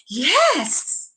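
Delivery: excited yes, swooping up and down. Shown as a demonstration. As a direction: push the pitch up high with excitement. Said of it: "even more enthusiastic if it's excited".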